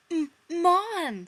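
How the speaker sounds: high stuttering panic; stammered. Acted. Chandler caught out, stammering high. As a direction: trip over her name, panicked. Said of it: "stammered Chandler".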